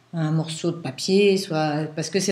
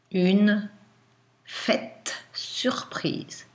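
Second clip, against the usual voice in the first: slow, word-by-word dictation; dictating; much slower than usual. Shown as a demonstration. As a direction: dictate slowly to a class.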